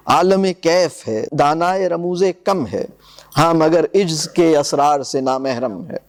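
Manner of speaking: reading the angels' complaint in verse; poem recitation. Acted. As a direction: recite gravely, letting the lines land.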